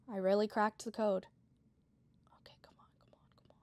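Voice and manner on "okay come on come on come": almost silent whisper. Real, not acted. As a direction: urge it on without voice. Hiding, urging.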